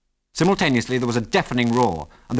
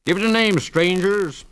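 loud, high call across the bar; loud barman call; 9 semitones higher, 4 dB louder than usual. A narrator's reading. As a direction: hail the strangers from behind the bar. Barman calls to newcomers.

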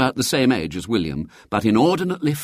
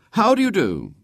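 high, raised-voice greeting; a boy's pointedly loud politeness, mildly raised; 13 semitones higher than usual. A narrator's reading. William greeting the cousin who insulted him, loudly. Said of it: "said William loudly".

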